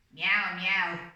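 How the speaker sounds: a cat's small pitiful meow, gently mimicked. The sick cat Hamish answering sadly, pitiful mock meow. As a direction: mew it like a sad, seasick cat. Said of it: "agreed Hamish pitifully".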